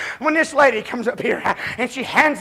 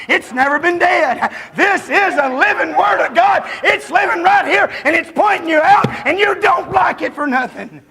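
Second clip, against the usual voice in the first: full preaching shout; 5 semitones higher than usual. Real, not acted. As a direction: cry out that the Word lives.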